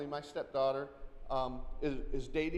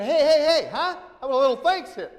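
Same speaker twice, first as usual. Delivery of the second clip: much louder and higher, demanding thanks; mock indignant shout; 10 semitones higher, 14 dB louder than usual. Chasing someone who skipped a thank-you, mock indignant yell. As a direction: chase them down, hammed-up outrage.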